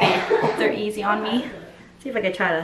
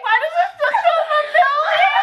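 laughing shout. Retold or imitated. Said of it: "I was like".

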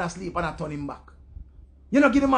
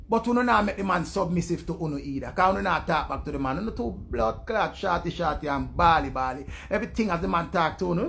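ordinary talk

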